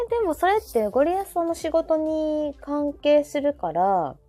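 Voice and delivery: usual speaking voice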